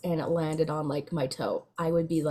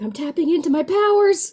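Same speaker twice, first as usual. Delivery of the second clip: loud, high, triumphant mock proclamation; hammed-up heroic shout; mock superhero cry; 10 semitones higher, 10 dB louder than usual. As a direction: declare it like a superhero powering up.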